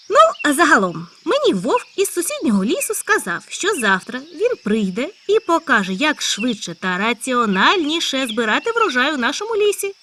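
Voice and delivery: high loud eager hare; a hare bursting with news. Acted. Hare character voice. (високий гучний схвильований заєць)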